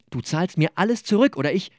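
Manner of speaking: höher und lauter gedroht; wütende Drohung. Acted. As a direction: fordere das Geld scharf zurück. Streit in der WG, Drohung. (dem Mitbewohner drohend, lauter und höher)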